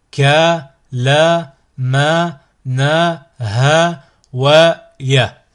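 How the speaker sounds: slow, separately drawn-out letter names; letter recital. Shown as a demonstration. Teacher models the Arabic letters for learners, letter recital. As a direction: sound out each letter by itself.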